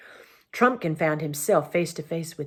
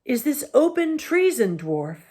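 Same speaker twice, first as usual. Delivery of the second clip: stern accusation; 7 semitones higher than usual. A narrator's reading. King accuses.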